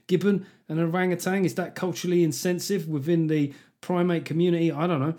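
ordinary delivery

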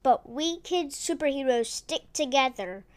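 usual speaking voice

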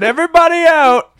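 high, loud retold holler; hammed-up closing-time shout; calling out. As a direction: holler it as if clearing the room. Retold order to clear room.

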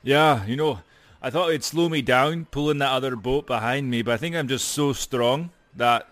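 ordinary delivery

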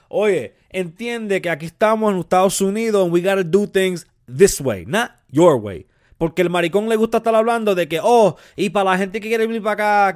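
heated, high and forceful scolding; angry rant. Acted. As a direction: tell him off loudly, mocking his talk. Angry bilingual rant at Jorge Ramos.

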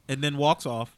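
usual speaking voice

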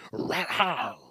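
weak imitated big-cat roar; failed animal sound. Shown as a demonstration. Host trying a tiger sound, failed animal roar. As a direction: attempt a tiger roar that falls short. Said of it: "I can't do animal sounds right now".

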